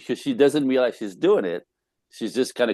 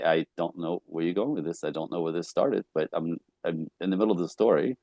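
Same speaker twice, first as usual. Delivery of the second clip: lower, softer, gentle admission; kind, polite; 4 semitones lower, 6 dB quieter than usual. Acted. Husband telling his wife he has lost the thread, kindly. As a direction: put it gently, keeping the voice down. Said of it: "kindly, politely".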